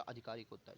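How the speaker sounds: आधिकारिक said softly, opening on a long "aa"; low, soft आधिकारिक with "aadhi-" at the start; long initial vowel. Shown as a demonstration. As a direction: restate आधिकारिक quietly with a long first vowel. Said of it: "आधिकारिक नहीं होता".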